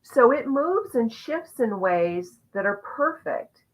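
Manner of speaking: ordinary delivery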